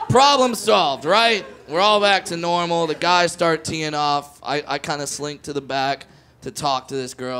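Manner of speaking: ordinary talk, nothing marked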